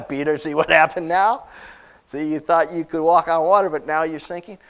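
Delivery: lightly taunting heckle, I-told-you-so; mocking onlooker. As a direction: rub it in, teasing the one who failed. Mock heckling from a disciple onlooker.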